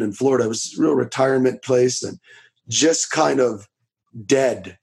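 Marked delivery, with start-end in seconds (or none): none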